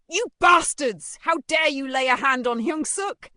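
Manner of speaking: loud, high furious outburst; enraged shouting. A narrator's reading. Mother confronts her son's bullies, shouting furiously. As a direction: scream at them in outrage. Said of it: "still burning with rage, shouted".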